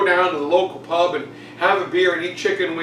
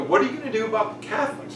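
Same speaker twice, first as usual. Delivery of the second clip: hurried question; 3 semitones higher, 5 dB quieter, much faster than usual. Retold or imitated. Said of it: "And they go".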